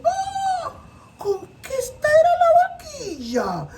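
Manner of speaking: very high, outraged discovery; indignant surprise. Acted. Tía Ballena finding the elephant on the rope, shocked and high. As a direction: gasp and realise you were tricked.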